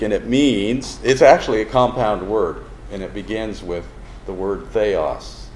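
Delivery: ordinary delivery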